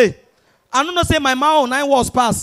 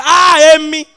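put-on Yoruba boy's outcry; 4 semitones higher, much slower than usual. Yoruba boy punched on the nose, drawn-out comic wail. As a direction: drag out the mock cry of a hit nose. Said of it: "Yoruba boy take and talk again".

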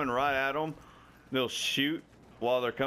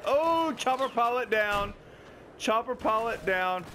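excited callout; 11 semitones higher, slower than usual. Real, not acted. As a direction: call the kill out in triumph. Gleeful callout of a kill.

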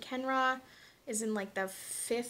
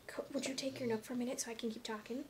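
much softer, quick aside; quiet aside; 11 dB quieter, faster than usual. Real, not acted. Parent asking baby to take the Nook, hushed aside. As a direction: drop the volume and ask gently.

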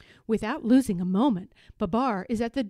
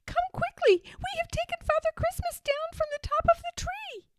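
very high, urgent squeaky voice; piping storybook mice; little mice. A narrator's reading. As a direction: hurry them along in a tiny mouse voice. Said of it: "answers the little mice".

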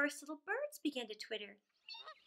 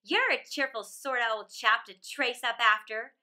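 put-on louder, bold teasing robber girl; brash robber girl; 3 semitones lower, 7 dB louder than usual. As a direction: tease him like a cocky bandit girl. Robber girl teases Kay.

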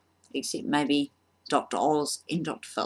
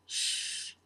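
a soft, voiceless hush; whispered shush; 9 dB quieter than usual. Acted. Fake applicant hushes interviewer, whispered shush. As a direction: hush the room conspiratorially.

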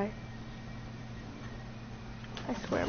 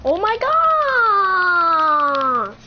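louder, higher, long-held wail; drawn-out exclamation; 4 semitones higher, 6 dB louder, much slower than usual. Real, not acted. Player reacting to trouble in the game, drawn-out cry. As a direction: stretch it out loud as it falls away.

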